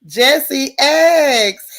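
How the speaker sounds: loud, drawn-out greeting to a viewer; happy, loud shout-out; excited shout-out. Real, not acted. Greeting viewer in live chat.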